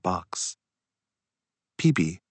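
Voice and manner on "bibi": plain reading voice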